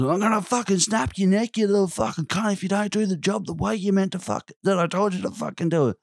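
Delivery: high mock angry coworker voice. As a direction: voice the old coworker's threat shrill and high. Coworker threatening, retold angrily.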